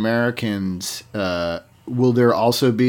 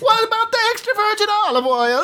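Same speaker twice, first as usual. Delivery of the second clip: high, fast, laughing cartoon Italian; Italian accent; faster than usual. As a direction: rattle it off like a hammy Italian. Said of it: "Stereotypical Italian, uh, uh, accents".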